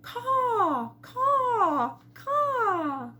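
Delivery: high cawing crow. A narrator's reading. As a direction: caw back like a crow. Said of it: "A crow answered back".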